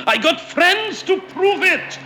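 very high, loud, furious protest; fury. A narrator's reading. Stranger defends his claim to Enoch Davis, furious. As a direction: shriek it in outrage. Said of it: "the stranger said in a fury".

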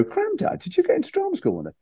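very high-pitched imitation of a grandchild; grandchild's voice. Grandchild asks grandad about drama school, high child voice. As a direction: put on a squeaky little grandchild asking Grandad. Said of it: "my grandchildren would discover it in the attic".

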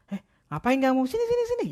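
very high, sweet coaxing call; very high cat call. Retold or imitated. Girl friend luring a stray cat over, very high and sweet.

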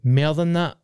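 a light hint of Trump. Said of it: "I'm talking like fucking Trump".

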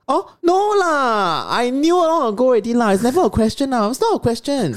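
playful high exclamation, imitating someone unfazed; brother's easy dismissal. Retold brother dismissing the question.

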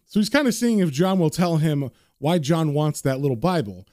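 usual speaking voice

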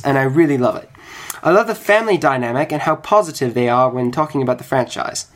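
put-on amateur British accent reading. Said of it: "He's gonna read it in a British accent".

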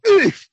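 high, loud cartoon grunt of pain; mock pained oof. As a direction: yelp like you just got kneed. Kneed in the balls.

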